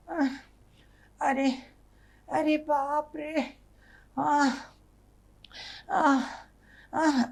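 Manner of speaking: high, soft, slow theatrical groans; hammed-up moaning. Wife wakes sleeping husband, moaning high and softly. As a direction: moan like a wife milking her illness. Wife fakes illness at night.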